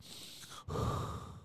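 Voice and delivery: a long, soft breath out, too stoned to move; sighing, wiped out and stoned; heavy stoned exhale. Retold or imitated. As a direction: let the air drain out of you, wiped out. Retelling being too stoned to move.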